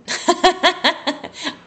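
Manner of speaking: loud, high burst of laughter; real helpless peal of laughter; self-amused laugh. Real, not acted. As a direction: laugh out loud at your own forgetfulness. Laughs at forgetting the quote.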